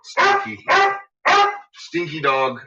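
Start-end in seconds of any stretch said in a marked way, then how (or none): 0.0-0.6 much higher squeaky teasing; squeaky pet talk. Acted. Owner teasing his smelly dog in a much higher voice.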